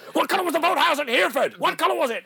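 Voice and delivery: high, loud, laughing mock De Niro; can barely get the line out for laughing; De Niro through laughter. Mocking De Niro's bad line, laughing hard. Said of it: "and he says".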